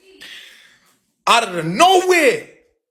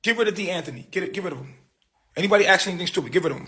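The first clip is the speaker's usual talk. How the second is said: normal talking voice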